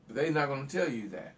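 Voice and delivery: ordinary talk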